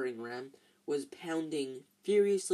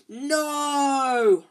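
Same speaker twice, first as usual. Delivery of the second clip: much higher and louder desperate cry; full panicked yell; 10 semitones higher, 10 dB louder than usual. A narrator's reading. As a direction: shout it as the wall rushes up. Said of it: "Ron yelled".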